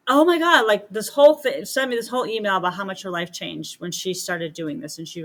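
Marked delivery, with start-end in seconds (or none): 0.1-0.7 excited exclaim. Retold or imitated. Client's excited reaction retold.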